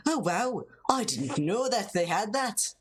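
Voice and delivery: bouncing mock-Irish melody; lyrical Irish accent. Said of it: "You gotta get that lyricalness".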